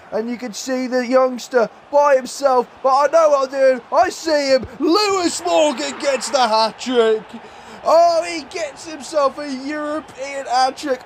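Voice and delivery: high, building hat-trick commentary; ecstatic goal call. Real, not acted. Calls the hat-trick goal live.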